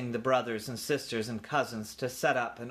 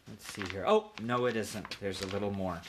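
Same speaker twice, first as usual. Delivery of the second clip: usual speaking voice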